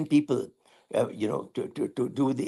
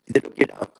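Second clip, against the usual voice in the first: ordinary talk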